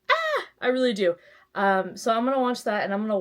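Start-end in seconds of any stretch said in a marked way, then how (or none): 0.1-0.4 short, very high squeal; excited squeal. Pretend. Vlogger can't wait for her YouTube video, squealing high. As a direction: squeal with eagerness.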